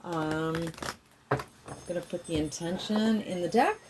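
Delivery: ordinary talk, nothing marked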